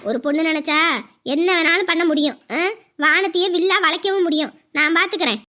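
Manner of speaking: ordinary delivery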